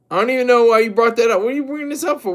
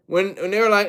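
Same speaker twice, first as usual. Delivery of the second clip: ordinary talk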